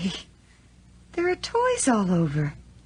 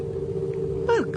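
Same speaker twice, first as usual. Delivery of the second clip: hushed; 13 semitones higher, 5 dB quieter than usual. Acted. Spotting footprints in snow.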